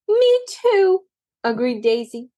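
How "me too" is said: very high eager squeal; eager agreement. A narrator's reading. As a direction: jump in to agree.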